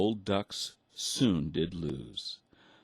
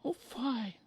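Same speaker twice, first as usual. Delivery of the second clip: very high mother duck voice; squeaky duck mother tutting; exclaiming mother duck. A narrator's reading. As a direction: scold like a prim mother. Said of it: "the mother duck exclaimed".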